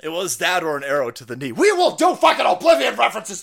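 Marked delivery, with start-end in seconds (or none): 1.5-3.4 hyped shout. Real, not acted.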